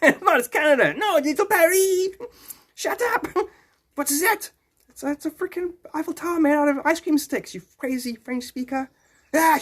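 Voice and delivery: very high, hammed-up cartoon voices; squabbling French speakers. Mocking locals arguing over Little Paris, high cartoon squabble. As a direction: bicker absurdly in a squeaky high pitch. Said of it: "they must act like".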